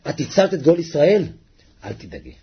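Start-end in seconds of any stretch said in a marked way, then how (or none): 1.8-2.3 reassuring. Acted. God comforts Pharaoh's daughter.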